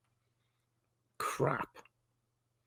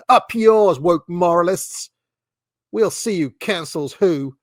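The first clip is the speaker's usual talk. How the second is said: mock defiance; 2 semitones higher, 5 dB louder, slower than usual. Host mimics people cheering the fake story, mock defiance. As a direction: crow it triumphantly in parody. Parodies a defiant online culture warrior.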